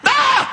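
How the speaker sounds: high, sharp indignant yelp; hammed-up outraged cry; mock outraged shriek. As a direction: squeal at being refused.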